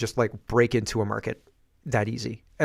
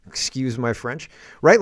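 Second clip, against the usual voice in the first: normal talking voice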